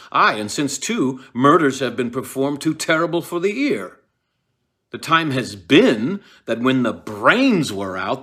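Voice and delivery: loud, wild rant, slowing on the brains line. Acted. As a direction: rage and rave at the ghost's return. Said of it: "Macbeth is still ranting".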